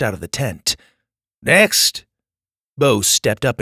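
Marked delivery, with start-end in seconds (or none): 1.4-2.0 put-on calling out in an Aussie accent; Australian accent. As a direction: call the next kid, Australian style. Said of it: "in an Australian accent".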